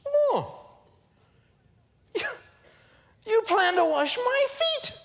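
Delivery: very high, loud, slow aghast cry; shocked Peter. Retold or imitated. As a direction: Peter can't believe it. Peter balks at Jesus.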